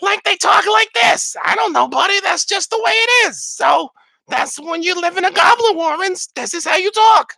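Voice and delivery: put-on a squawking goblin in an old-timey New York accent. Shown as a demonstration. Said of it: "all sound like old-timey New Yorkers".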